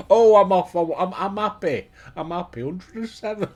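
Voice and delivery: loud, high, laughing helplessly; real uncontrollable laughter; laughing fit. Real, not acted. Laughing at the game with viewers, real laughing fit. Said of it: "I'm crying".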